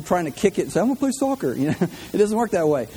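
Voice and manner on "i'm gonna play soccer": mock silly voice. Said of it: "you don't have people going".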